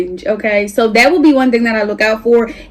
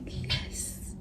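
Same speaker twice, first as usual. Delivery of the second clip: whisper; 23 dB quieter than usual. Retold or imitated. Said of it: "I'm like".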